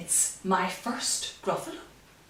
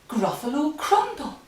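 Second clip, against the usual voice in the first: higher-pitched storybook mouse; mouse's voice; 7 semitones higher, 3 dB louder than usual. A narrator's reading. Mouse threatens to eat the Gruffalo, high mouse voice. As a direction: deliver it high, as the mouse's punchline. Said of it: "said the Mouse".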